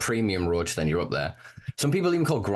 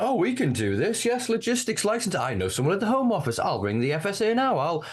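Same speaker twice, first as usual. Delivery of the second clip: a hint of a smug, connected businessman, much higher; mock businessman; 9 semitones higher than usual. Mocking the rich newcomers in business suits, hammed-up smug voice. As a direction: toss it off like a well-connected suit. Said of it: "they come in in their business suit".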